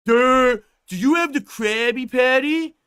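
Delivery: a dopey caller on the phone; dumb caller. Retold or imitated. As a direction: play a dopey caller asking.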